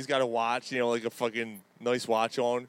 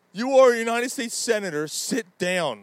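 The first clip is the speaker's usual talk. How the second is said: higher, louder mock scolding chairman; mimicking Bernie yelling; 10 semitones higher, 7 dB louder than usual. Host imitates Sanders telling a senator to sit, mock scolding. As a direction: scold like an old senator calling order. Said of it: "Sanders is yelling at Senator Mullen".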